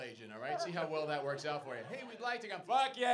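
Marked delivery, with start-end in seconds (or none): 1.9-2.6 high, mock-keen customer; eager customer. Acts out travel agency customer. 2.7-3.1 loud, high mock yell; explosive comic rebuff; rude agent's shout. Acts out rude Aussie travel agent.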